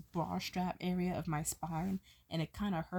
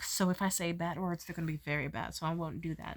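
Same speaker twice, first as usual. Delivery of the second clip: ordinary talk, nothing marked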